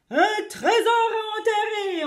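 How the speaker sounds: high, slow, loud crew echo; pirate crew hollering the words back, drawn out; crew shouting. A narrator's reading. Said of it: "ont crié les autres".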